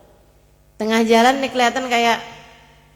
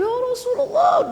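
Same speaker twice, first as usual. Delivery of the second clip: high-pitched mock wail; imitated weeping; 12 semitones higher than usual. Old man hears of the Prophet's dream message, imitated wail. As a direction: act out a tearful high cry. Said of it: "Nangis-nangis dia udah".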